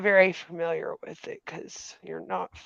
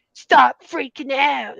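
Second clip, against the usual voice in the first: mocking quote; 7 semitones higher, 7 dB louder, slower than usual. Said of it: "all like".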